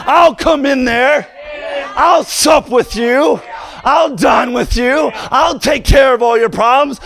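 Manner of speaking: preaching at full force. Acted. Preacher urging the congregation that God speaks, shouting slowly. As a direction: build the fire and hammer each phrase.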